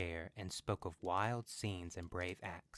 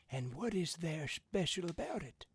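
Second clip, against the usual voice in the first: put-on higher curious old man; 9 semitones higher than usual. A narrator's reading.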